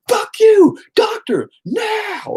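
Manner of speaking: very high, strained re-enacted yell; furious demand. Father cutting off hospital staff, furious high yell. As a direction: punch out each demand in a high shout. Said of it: "I was just pissed".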